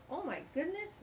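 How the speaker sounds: plain reading voice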